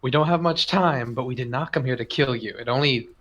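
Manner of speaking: ordinary talk, nothing marked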